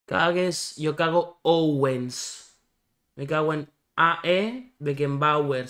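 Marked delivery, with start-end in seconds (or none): 0.0-2.6 slow and deliberate, each syllable laid out; slow rhyme demo. Shown as a demonstration. Showing friends the rhyme, said slowly. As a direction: drag it out so the rhyme is heard.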